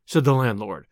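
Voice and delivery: usual speaking voice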